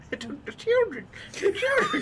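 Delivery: put-on high, loud cackling old-timer; old prospector. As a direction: wail it like a mad old miner. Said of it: "prospector bit".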